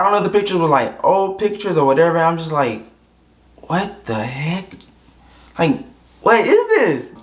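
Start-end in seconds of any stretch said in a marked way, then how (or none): 3.6-7.1 drawn-out, stunned what-the-heck; slow bewildered voice, rising on the second line; slow disbelief. Retold or imitated. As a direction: stretch it out, baffled. Reacting to mismatched looks.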